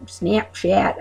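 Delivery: "Snapchat" slow, with hicky drawn a; Midwest accent. Retold or imitated. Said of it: "make it sound hicky".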